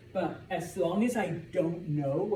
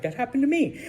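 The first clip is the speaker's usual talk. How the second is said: high, loud mock student interjection; Logan chiming in; 10 semitones higher, 6 dB louder, faster than usual. Logan interrupting teacher in class, mock excited. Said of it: "Logan's like".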